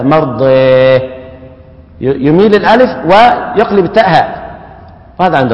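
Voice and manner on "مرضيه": مرضيه with the long a leaned toward e, sung out; recited مرضيه, alif tilted to e; with imala. Shown as a demonstration. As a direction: chant مرضيه with imala and a final h. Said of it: "يقف الكسائي هكذا".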